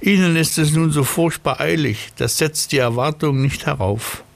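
high, self-important snail. Acted. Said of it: "sagte die Schnecke".

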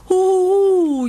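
long, loud high-pitched howl; puppy howl. A narrator's reading. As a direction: howl mournfully like a lonely pup. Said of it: "jault Bandit".

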